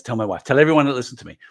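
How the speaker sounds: usual speaking voice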